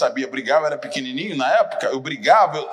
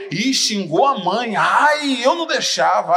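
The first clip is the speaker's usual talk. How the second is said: put-on raised, louder taunting kid's voice; mock instigating kid; 6 semitones higher, 5 dB louder, slower than usual. Schoolyard kid stirring up the fight, mock taunting, raised and louder. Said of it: "o outro falava assim".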